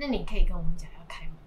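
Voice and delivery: half-whispered reply; whispering. Real, not acted. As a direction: say it under your breath. Teen answers mother back quietly. (小声嘀咕)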